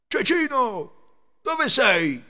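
loud, high, drawn-out call. A narrator's reading. Thieves searching the stable for Cecino, calling out loudly. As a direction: call out slowly for someone lost. Ladri lo cercano chiamando.

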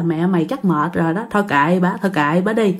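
low, soft resigned aside; quiet, low, giving up on her; resigned father. Retold or imitated. Father resigned, talking about mother.

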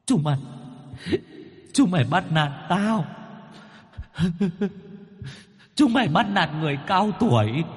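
put-on high, whining old ghost near tears; whimpering ghost. Bound ghost whines to exorcist Phong that they bully him, high and tearful. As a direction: complain like a bullied old man about to cry. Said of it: "Con vong mếu máo".

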